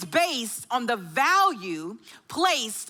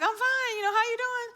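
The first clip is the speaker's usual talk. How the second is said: put-on higher, light innocent teen voice; innocent son; 8 semitones higher, 3 dB quieter than usual. Son playing innocent to his mother on the phone, high and light. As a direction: play dumb as the son.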